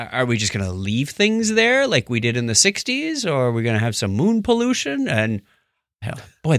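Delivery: normal talking voice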